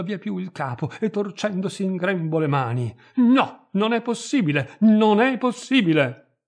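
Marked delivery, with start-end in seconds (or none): 3.2-4.8 "non è possibile" gridato più acuto e forte; gemito disperato. A narrator's reading. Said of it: "gemette lei". 4.8-6.4 put-on "non è possibile" ripetuto più forte e lento, accento su "è"; insiste, allungando la disperazione; lamento gridato. A narrator's reading. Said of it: "gemette lei".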